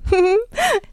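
high, acted giggle; short high laugh in a girl's voice. A narrator's reading. Girl amused by the other's retort, high giggle. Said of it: "Das Mädchen lachte".

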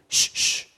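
two sharp shushes; hushing. A narrator's reading. Companion restrains the tactless newcomer, hushing him. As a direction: shush someone to stop them. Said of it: "während seine Begleiterin ihm Einhalt gebot".

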